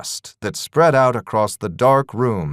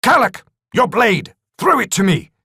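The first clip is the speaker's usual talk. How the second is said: higher, louder urgent shout; shouted call; 10 semitones higher, 3 dB louder than usual. Acted. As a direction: call for the blade mid-fight. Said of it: "he thought and shouted".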